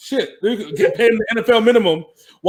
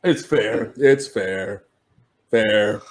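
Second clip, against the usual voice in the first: low monotone; 9 semitones lower, much slower than usual. Retold or imitated. Repeating the monotone impression.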